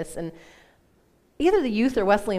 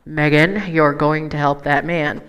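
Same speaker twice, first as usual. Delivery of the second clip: louder, low and slow, final; commanding Holy Spirit; 4 semitones lower, 8 dB louder, slower than usual. Retold or imitated.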